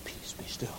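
hushed, voiceless whisper; barely audible breath of calm. Acted. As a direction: whisper it like settling waves. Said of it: "That's when the whispering occurred".

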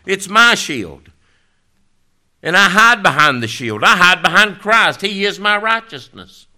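loud, high, rising preacher's cry; full-force preaching. Acted. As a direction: preach it out to the whole church.

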